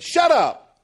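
loud, high shout cutting him off; shouted retort. Acted. David answers the giant's taunt, shouting. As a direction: fire it out at full volume, pitch jumping.

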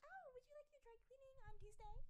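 chirpy call-center politeness; customer service voice. Retold or imitated. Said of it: "It's like my customer service voice".

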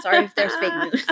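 quick giggly sorry; laughing apology. Real, not acted.

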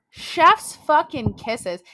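usual speaking voice

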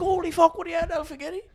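very high mock-astonished greeting; delighted recognition. Bar owner recognising the Alpha Getty Gobbler, acted astonishment. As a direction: squeal a long-lost-pal hello, acted. Terry recognising his old friend.

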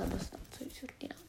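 unvoiced whispered repeat; whispered echo. Real, not acted. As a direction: whisper it back to yourself as an aside. Echoes own words as aside.

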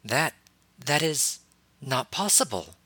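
stunned, faltering disbelief; halting, caught off guard; shocked stammer. Acted. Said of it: "a rare moment of complete shock".

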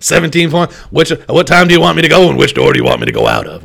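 lightly imitated coach; Ed Orgeron. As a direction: toss out the coach's line in mild imitation. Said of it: "he was saying this in an interview".

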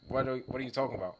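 ordinary talk

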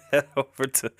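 breaking into laughter mid-read; can't get the line out for giggling; soft giggles breaking up the plug; laughing fit. Real, not acted.

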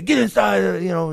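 high, urgent parental call; strict parents. Retold or imitated. Parents ordering their kid inside, scolding.

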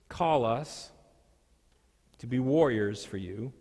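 usual speaking voice

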